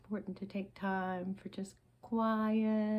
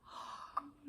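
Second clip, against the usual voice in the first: 1 demonstrated sigh; 8 semitones higher, 7 dB quieter than usual. Shown as a demonstration. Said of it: "Sigh a little bit".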